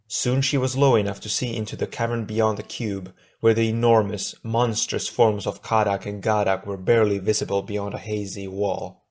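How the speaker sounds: plain reading voice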